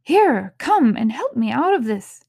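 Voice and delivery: loud, high irritated command; angry. Acted. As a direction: order him to help, annoyed. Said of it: "said the rabbit angrily".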